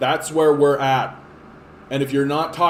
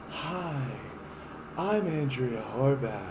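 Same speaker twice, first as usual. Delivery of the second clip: hushed, slow mock campaign-ad voice; softly parodying a soft-sell candidate; 5 semitones lower, 10 dB quieter than usual. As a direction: whisper it like a gentle political ad. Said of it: "piano music and soft, calming statements".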